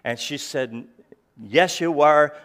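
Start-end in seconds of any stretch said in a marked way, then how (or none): 1.4-2.4 slower and louder, firm and final; wife's stern insistence. Retold or imitated. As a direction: say "Yes, you are" like the look that ends the argument. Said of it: "with the look".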